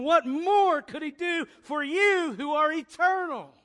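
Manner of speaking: full-throated preaching climax; rising sermon shout. Acted. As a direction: lift the crowd to a peak.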